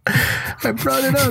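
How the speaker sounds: very high, drawn-out squeal; high-pitched protest. Retold or imitated. Brother answers the old man asking for food, high helpless protest.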